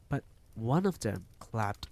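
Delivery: ordinary delivery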